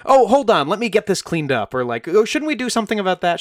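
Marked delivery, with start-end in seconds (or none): 0.0-1.7 high, bright-toned interjection, easing down; raised pitch, loud start; babysitter defusing a fight. Retold or imitated. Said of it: "good at going like". 2.1-3.4 high, quick distracting suggestion; pitched up and rushed; babysitter defusing a fight. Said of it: "good at going like".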